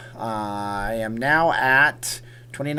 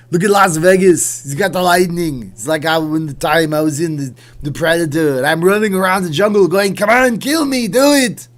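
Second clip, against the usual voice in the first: high, yelled action-hero parody; Arnold; 6 semitones higher, 10 dB louder than usual. Acted. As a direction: roar it like a hammed-up Austrian action star. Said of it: "you got to hear the Arnold".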